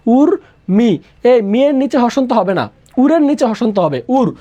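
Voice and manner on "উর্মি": "উর্মি" split slowly into ur, then mi; syllable-by-syllable pronunciation. Shown as a demonstration. Teacher showing how the word is pronounced, "উর্মি" carefully split as ur-mi. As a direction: say "উর্মি" in two clear parts, ur and mi. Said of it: "উর্মি শব্দের উচ্চারণ কি হবে".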